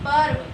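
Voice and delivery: पर्व with the र sounded ahead of व; र before व. Shown as a demonstration. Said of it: "व से पहले र बोला जा रहा है". (पर्व में व से पहले र का उच्चारण)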